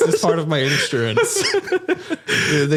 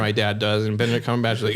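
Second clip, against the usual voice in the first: normal talking voice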